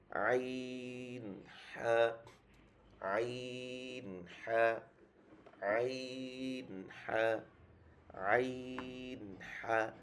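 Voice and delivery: আইন drawn long and narrow, হা short and breathy; throat letter drill. Shown as a demonstration. Teacher drilling throat letters for students, আইন narrow and long. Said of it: "অনেক সংকীর্ণ হয়ে যাবে".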